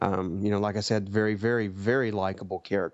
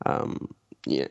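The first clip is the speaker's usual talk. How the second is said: usual speaking voice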